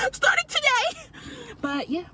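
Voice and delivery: drawn-out hyped declaration; excited shout. Real, not acted. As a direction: announce it like a triumphant battle cry. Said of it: "Taking it serious".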